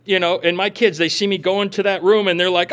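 normal talking voice

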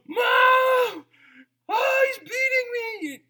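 high, loud fake-crying child shout; little daughter screaming for mom while dad drags her out. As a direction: wail to mom like a kid faking a beating.

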